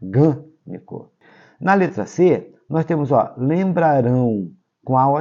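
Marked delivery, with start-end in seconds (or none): 0.0-1.1 "gânico" with GÂ drawn out, then a break; "gânico" broken slowly into syllables, leaning on GÂ; stress on GÂ. Shown as a demonstration. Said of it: "e sim o nosso acento circunflexo".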